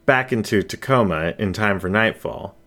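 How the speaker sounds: ordinary delivery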